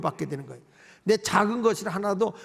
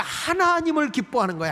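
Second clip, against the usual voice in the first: ordinary talk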